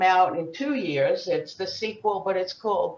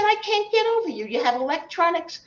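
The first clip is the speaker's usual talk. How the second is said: much higher and fast, amazed; high astonished voice; 8 semitones higher, faster than usual. Retold or imitated. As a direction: squeal in mock disbelief at the girls. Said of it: "But I said".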